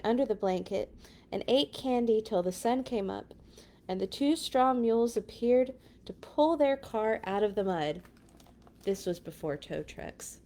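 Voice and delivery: ordinary delivery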